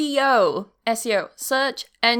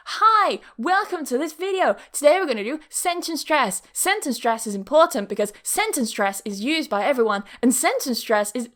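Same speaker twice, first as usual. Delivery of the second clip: high, swooping mock video-intro voice; overdone sing-song presenter, hammering the key phrase; unnatural keyword-stuffed intro; 3 semitones higher than usual. Shown as a demonstration. Showing learners how not to say keywords in a video, unnaturally. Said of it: "Do it in a natural way".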